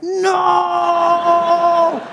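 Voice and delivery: long, high mock-horror cry; panicked yell. Driver seeing an oncoming car, high drawn-out yell. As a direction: stretch it out in mock terror.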